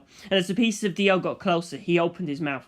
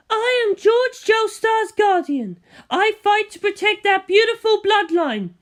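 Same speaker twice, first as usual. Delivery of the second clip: much higher, louder heroic woman's shout; Elizabeth's battle cry; 14 semitones higher, 7 dB louder than usual. Acted. Elizabeth striking Antonio, shouting her battle cry. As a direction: proclaim it like a fierce warrior woman. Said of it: "and shouted".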